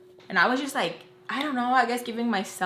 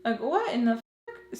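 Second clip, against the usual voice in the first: higher, indignant mock outrage at her leaving; retold angry rant, pitched up; pissed-off rant; 6 semitones higher, 3 dB louder than usual. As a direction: squawk it up high like you can't believe she left you. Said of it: "I'm pissed off 'cause I'm like".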